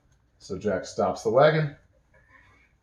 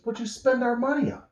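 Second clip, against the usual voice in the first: indignant question; 14 semitones higher than usual. Acted. GM voicing Brett, indignant.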